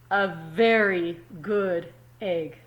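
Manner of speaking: slow, proud a very good egg. Acted. Teacher quoting the egg's boast, slow and proud. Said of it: "So she says".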